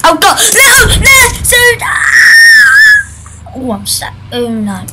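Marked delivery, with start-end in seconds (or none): 1.4-3.5 very high, loud panicked shriek. Real, not acted. Gamer shrieking as he gets killed. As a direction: scream as you get killed. Dying in game.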